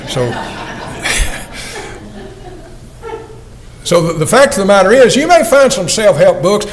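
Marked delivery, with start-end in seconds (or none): 0.4-2.5 quick airy chuckle at the punchline; preacher laughing at his own joke; soft laugh. Real, not acted. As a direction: let a soft laugh slip out.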